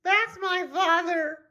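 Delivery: put-on very high squeaky boy voice; high squeaky boy, slow on his name; squeaky David voice. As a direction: squeak it out like a cartoon kid. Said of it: "said David".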